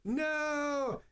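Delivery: sudden high cry; anguished no. Retold or imitated. Retelling Luke's scream in the film, mock yell. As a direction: yell it out like Luke in agony. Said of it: "And he's like".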